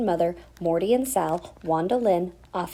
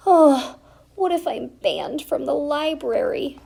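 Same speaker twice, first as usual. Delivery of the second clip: sobbing; 8 semitones higher, 4 dB louder than usual. Acted. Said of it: "sobbed Stella".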